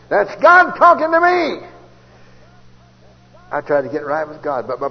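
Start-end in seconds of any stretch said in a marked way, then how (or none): 0.1-1.7 God stressed high and loud, slow; preached out, high and loud; louder, higher slow repeat. Shown as a demonstration. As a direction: ring it out like a preacher's climax. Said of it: "Oh, that's God talking to me".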